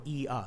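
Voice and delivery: "E-a" as ee gliding into a; Chicago A demo. Shown as a demonstration. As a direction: slide "E-a" from ee to a. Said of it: "to do the Chicago A".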